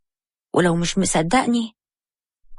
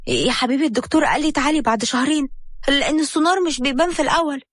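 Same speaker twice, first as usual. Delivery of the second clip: put-on higher, worked-up young wife brushing him off; agitated Habiba; 6 semitones higher than usual. A narrator's reading. Wife dodging the doctor visit, higher and worked up. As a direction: rattle off excuses, voice pitched up. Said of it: "حبيبة انفعلت عليه".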